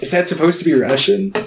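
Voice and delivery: acted puzzlement. Viewer finally hearing the bad accent, mock puzzlement. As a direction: ask it in mock confusion.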